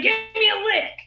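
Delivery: high, loud insistent demand. Retold or imitated. Big sister demanding her brother's sucker, loud and high.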